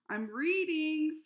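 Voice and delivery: slow, loud call back; shouting back. A narrator's reading. Flora answering her mother, slow loud call. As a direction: drag it out, yelling back. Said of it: "Flora shouted back".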